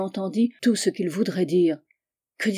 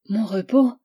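incredulous echo; 2 semitones higher, 4 dB louder than usual. A narrator's reading. Charlotte echoes in disbelief.

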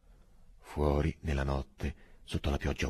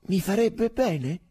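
mildly intrigued; 5 dB louder, slower than usual. A narrator's reading. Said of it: "chiese Angustina come incuriosito".

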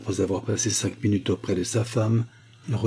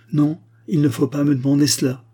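frightened; 6 semitones higher, 6 dB louder than usual. A narrator's reading. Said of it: "d'un air effrayé".

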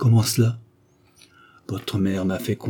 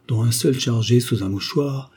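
plain reading voice

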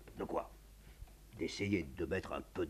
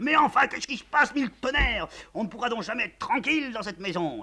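higher, louder furious yell; 10 dB louder than usual. Acted. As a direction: roar at the chaos in the house. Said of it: "Le général hurle".

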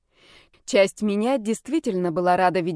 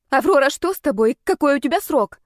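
higher, urgent string of questions; agitated chatter; 6 semitones higher, 4 dB louder than usual. A narrator's reading. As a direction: fire off worried questions, pitched up. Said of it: "затараторила она".

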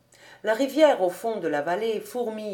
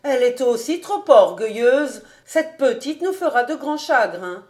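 louder, raised-voice complaint; acted motherly outburst, loud; exclaiming; 4 semitones higher, 7 dB louder than usual. A narrator's reading. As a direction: cry out in reproach, higher toward the end. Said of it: "s'écria la mère Horschel".

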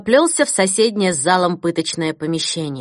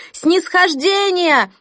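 slow, high, loud chanting cry; crowd shout; 11 semitones higher, 4 dB louder, much slower than usual. Acted. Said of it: "зал взорвался от женских криков". (выкрик толпы)

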